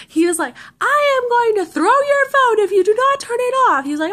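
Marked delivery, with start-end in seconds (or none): 0.8-3.8 high, loud mock threat; imitating angry brother. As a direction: yell a threat like an exasperated brother. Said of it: "He's getting really, really angry".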